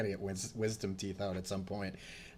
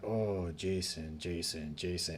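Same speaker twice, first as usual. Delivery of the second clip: low, slow, sighing dentist; imitated dentist's weary reproach, sinking lower; disappointed dentist; 3 semitones lower, 3 dB quieter, slower than usual. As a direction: drag out the name in let-down disapproval. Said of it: "a Chinese dentist".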